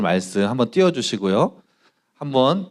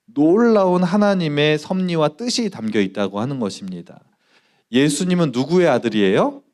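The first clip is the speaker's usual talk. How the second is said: normal talking voice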